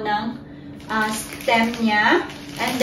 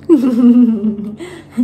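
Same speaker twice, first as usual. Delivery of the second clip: loud laugh; 3 semitones lower, 6 dB louder than usual. Real, not acted. Laughing with the baby.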